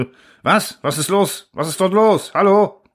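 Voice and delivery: high, urgent questions over the radio; alarmed calling. A narrator's reading. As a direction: call out in rising alarm, pitch climbing. Radio report breaks off into alarm.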